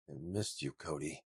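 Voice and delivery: soft near-whisper, barely voiced. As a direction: breathe it out softly. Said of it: "Roman whispered".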